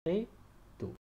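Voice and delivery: "Très" then "tôt" apart, tôt long and open; slow repeat. Shown as a demonstration. Teacher isolating the word tôt for pupils. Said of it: "un accent circonflexe sur le O".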